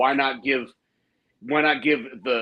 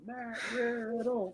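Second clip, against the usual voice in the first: high, soft, drawn-out name call; small sing-out in a mock happy voice; Matt Riddle; 8 semitones higher, 10 dB quieter, slower than usual. Echoing the wrestler's entrance greeting, soft high mock voice.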